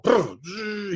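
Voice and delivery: buzzing sputter into a steady whine; imitated saw starting. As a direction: mimic a chainsaw roaring to life. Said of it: "one pull".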